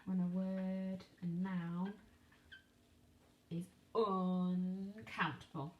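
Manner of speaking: slowly, each word weighed; slow emphasis. Shown as a demonstration. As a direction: lay out the rule slowly for children. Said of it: "teaching a rule".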